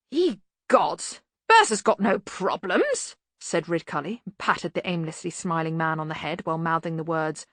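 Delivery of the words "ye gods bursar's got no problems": louder, higher, drawn-out bluster; blustering Arch-Chancellor. Acted. Arch-Chancellor brushing off the bursar's problem, blustering. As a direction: dismiss it with hearty bluster. Ridcully character dialogue.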